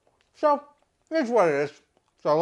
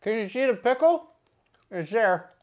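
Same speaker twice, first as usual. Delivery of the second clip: talking while chewing; showing food in mouth; mouth full. Real, not acted. Seen 0: Bite at -7; chewed food and pickle visible in open mouth.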